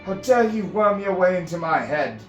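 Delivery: loud, high, clipped outrage; furious yell. Acted. Finn yelling at the intruding priestess, furious. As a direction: yell it, biting off each word. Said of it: "Finn yelled, words clipped with furious anger".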